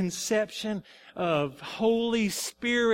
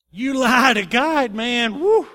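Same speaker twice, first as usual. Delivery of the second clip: loud, drawn-out warning with a whoop; emphatic exclamation; 3 semitones higher, 9 dB louder, slower than usual. Real, not acted. Preacher reacting to Ananias's fate, loud and awed. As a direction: punch it out slowly and loudly.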